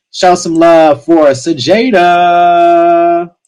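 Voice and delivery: slow drawn-out stage call; announcer call. Acted. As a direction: stretch it out to hype the crowd. Host calling next poet to stage.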